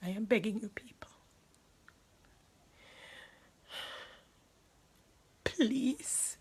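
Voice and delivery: a broken, toneless whispered begging; whispered plea. Real, not acted. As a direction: barely breathe the plea out. Pleads with the audience, drained.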